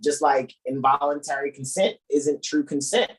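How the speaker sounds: usual speaking voice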